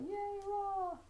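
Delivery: high, drawn-out mock cheer; long high-pitched hooray; cheering little men. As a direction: cheer like tiny cartoon soldiers. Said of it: "I can see the little men ... going".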